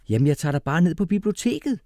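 cheerful, high-pitched father; glad. A narrator's reading. Father happily proposing a library trip, high and cheery. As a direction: lift the voice high, pleased with the plan. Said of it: "sagde han glad".

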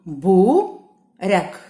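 Буряк as two separate beats, Бу, ряк; loud, spaced syllables of Буряк; split into syllables. Shown as a demonstration. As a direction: pause between Бу and ряк in Буряк. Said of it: "Ділимо слово на склади".

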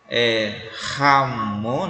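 "Ramon" devagar, com R forte aspirado; pronúncia lenta de Ramon. Shown as a demonstration. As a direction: alonga "Ramon" e marca o R da garganta. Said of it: "Som de R". ("Ramon" dito devagar, R forte como em rato)

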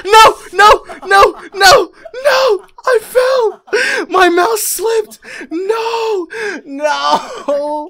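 very high, loud, repeated cries of despair; anguished screaming. Real, not acted. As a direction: wail in panic as it all goes wrong. Falls and dies in game.

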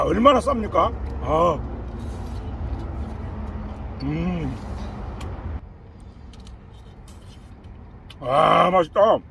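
chewing while talking; biting into food; mouth full of pizza. Real, not acted. Seen 3: Pizza held in mouth at 0–1.6, then chewing with lips closed.

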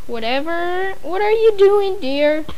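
high, nervous mother's voice. A narrator's reading. Frightened mother asking her son about dynamite, nervous. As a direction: ask timidly, fearing the answer. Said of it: "asked Missus Bartleby nervously".

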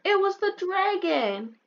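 put-on high, slow child blaming the dragon; squeaky kid excuse. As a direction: drag it out like a guilty little boy. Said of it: "you'll tell her".

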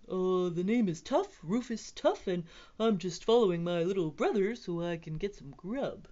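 goofy put-on character voice; middle dinosaur brother. A narrator's reading. Rufus explains himself to the T-Rex, put-on voice. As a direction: introduce yourself in an odd dinosaur voice. Rufus answers in his own voice.

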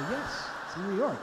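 laughing through the words. Retold or imitated. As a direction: chuckle while you answer. Dad laughs answering son.

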